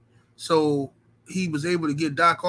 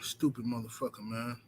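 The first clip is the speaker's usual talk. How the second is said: upset low muttering; 6 semitones lower, 15 dB quieter than usual. Real, not acted. Owner grumbling about his lost dog, low and soft. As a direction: lower and much softer. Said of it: "I'm upset".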